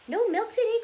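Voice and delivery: high, loud dismayed cry; cried out. Acted. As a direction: shout it in disbelief like the shocked farmer. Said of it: "cried Farmer Brown".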